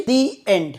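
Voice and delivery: "the" pronounced "dee" before "end". Shown as a demonstration. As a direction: say "the" with a long ee. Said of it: "দি উচ্চারণ করা হচ্ছে". ("the"-কে "দি" উচ্চারণ)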